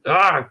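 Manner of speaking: short high shout of rage; villain's sudden angry cry; roar. A narrator's reading. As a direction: blurt it out in fury. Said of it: "Count Olaf roared".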